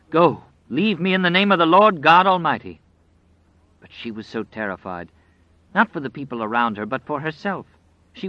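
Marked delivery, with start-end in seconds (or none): 0.1-2.8 loud, high, desperate command; desperate shout. Acted. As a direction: cast him out with all your strength. Old woman banishing the evil one.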